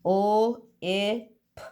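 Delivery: each letter sound said separately, with pauses between. Shown as a demonstration. Teacher to pupils, sounding out a word letter by letter. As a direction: split the word into its single sounds for the class. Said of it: "If you're saying".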